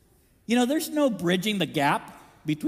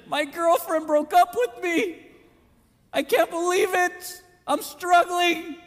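slow, higher mock-whiny complaint; lightly voiced moping student; 9 semitones higher, slower than usual. Preacher mimicking a heartbroken student, mock whiny.

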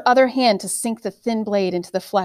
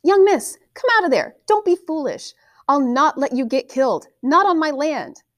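put-on calling to the girl; 7 semitones higher than usual. A narrator's reading. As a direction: call her out of the pen, pleading. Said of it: "calling to the girl".

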